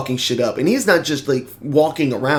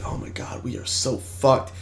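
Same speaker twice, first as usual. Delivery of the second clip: hushed, whispered dread, fading; soft frightened whisper; panicked whisper; 4 semitones lower, 9 dB quieter than usual. Retold or imitated. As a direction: breathe it out in quiet terror. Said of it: "She's like".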